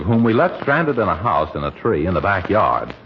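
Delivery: ordinary delivery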